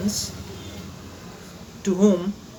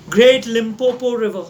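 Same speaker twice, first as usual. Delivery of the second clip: usual speaking voice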